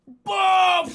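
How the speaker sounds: huge high shouted boom; imitated explosion. Dreamer imitating the jet crash heard underwater, explosive shout. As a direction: belt out the blast as a sound effect. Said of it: "I just hear".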